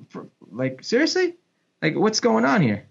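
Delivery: seriously jumps up high in retold disbelief; incredulous. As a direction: replay your own stunned reaction, pitch leaping. Retold disbelief at Zlatan's accusation.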